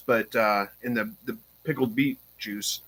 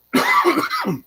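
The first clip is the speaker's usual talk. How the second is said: coughing on dust; 5 dB louder than usual. Real, not acted. Inhaled dust or caught gnat.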